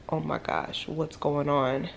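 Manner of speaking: panicked hush. Retold or imitated. Panicking in car, retold.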